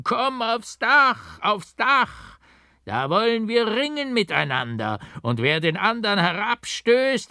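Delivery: high, loud, stretched howl of a madman calling up from the cart; howling mad monk. A narrator's reading. The doppelganger monk taunts his double from the cart, loud high howl. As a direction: howl it up at him like a crazed double. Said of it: "lachte und heulte herauf".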